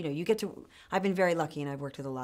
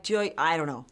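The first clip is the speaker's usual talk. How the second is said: put-on Jamaican accent; 7 dB louder than usual. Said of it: "I'm, like, talking like".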